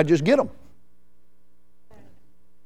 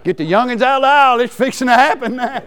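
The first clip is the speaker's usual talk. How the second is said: laughing warning; 6 semitones higher, 5 dB louder, faster than usual. Acted. Joking about his excitement.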